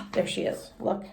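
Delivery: unvoiced hushed aside; whisper. Real, not acted. Teacher whispers to the class about Junie B. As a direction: whisper like sharing a secret. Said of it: "whispered aside to class".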